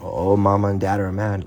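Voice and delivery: usual speaking voice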